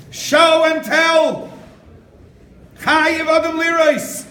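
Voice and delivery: slow, loud, high declamation; emphatic proclamation. Real, not acted. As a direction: proclaim each word to the whole room. Preacher proclaims his key text.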